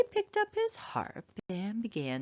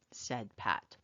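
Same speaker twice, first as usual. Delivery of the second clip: ordinary delivery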